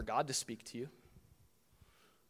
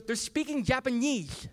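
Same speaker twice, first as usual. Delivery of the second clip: put-on high little-girl voice with a lisp; Evie; 9 semitones higher, 3 dB louder than usual. Daughter explaining the cartoon to dad, high lisping child voice. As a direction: imitate a small child's lisping complaint. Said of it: "She has this little lisp".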